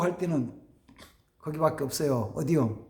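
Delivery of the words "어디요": normal talking voice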